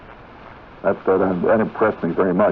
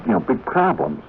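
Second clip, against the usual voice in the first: ordinary delivery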